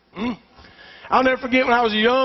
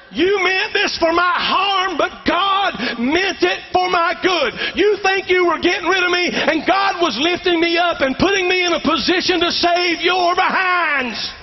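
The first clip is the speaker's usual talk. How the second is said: higher, slow, proclaiming Joseph; Joseph to his brothers; 6 semitones higher than usual. Acted. Joseph declaring God's plan to scared brothers, higher and slow. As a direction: preach it as Joseph, rising up. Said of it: "he said to them".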